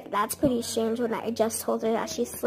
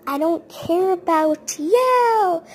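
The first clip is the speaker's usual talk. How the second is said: put-on loud, high, drawn-out child's shout; mock screaming tantrum; 5 semitones higher, 8 dB louder, slower than usual. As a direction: yell it like a kid throwing a fit. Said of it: "She started screaming".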